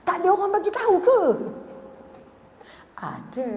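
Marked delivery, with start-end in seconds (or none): 0.1-1.2 astonished bystanders. Retold or imitated. Bystanders amazed at calm.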